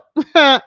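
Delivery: high, loud delighted laugh; loud burst of laughter. Real, not acted. Laughing at a shared comment.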